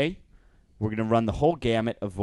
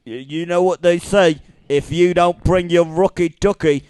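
hammed-up Cockney crook; London Cockney; 3 semitones higher, 7 dB louder than usual. Shown as a demonstration. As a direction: recite a saying in broad Cockney. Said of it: "London Cockney".

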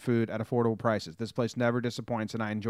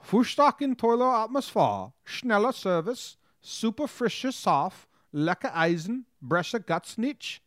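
hammed-up German accent, higher; beer fest German accent; 8 semitones higher than usual. Reading a German review aloud to his cohost, hammy German accent. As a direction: read it like a Bavarian beer hall host, in a German accent. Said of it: "beer fest in your German accent".

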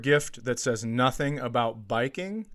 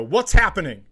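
urgent, raised-voice question; loud retold demand; 4 semitones higher, 8 dB louder than usual. Mother running up to her daughter, loudly demanding. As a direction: call it out loud and higher. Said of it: "just as loud".

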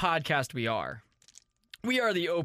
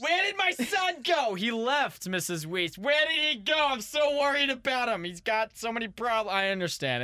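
Missus Weiss; 6 semitones higher than usual. Pretend. Host plays a guest's mother asking for her son, high and fretful. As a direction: fret like an anxious mom looking for her son. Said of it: "So if Ryan's mom would like to please, here, come inside".

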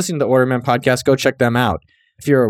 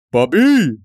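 put-on Italian accent; 4 semitones higher than usual. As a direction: belt out Bobby like an Italian uncle. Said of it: "just sounds Italian".

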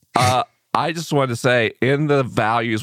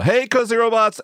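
show intro voice; 8 semitones higher than usual. Shown as a demonstration. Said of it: "I'll just start the show".

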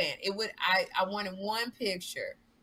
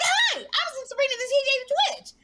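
overeager fan voice; 4 dB louder than usual. Retold or imitated. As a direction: squeal it out, eager to be friends. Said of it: "she was like".

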